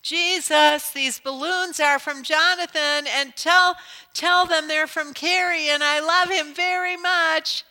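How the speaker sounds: put-on high, loud little girl calling up to the sky; child shouting to heaven. Little Carrie calling after her balloons, high loud child voice. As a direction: call up to Jesus like a small girl. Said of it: "she shouts up after them".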